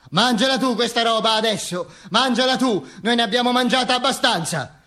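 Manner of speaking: high, insistent heckle from the crowd; indignant jeer. Acted. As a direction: throw it back at him in a high voice. Acted angry woman throwing rotten food. (invettiva acuta e indignata)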